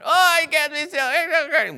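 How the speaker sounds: high, slow drawn-out whine; sulky child refusing to be still; bratty child whine. Acted. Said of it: "He can't bless the bratty child".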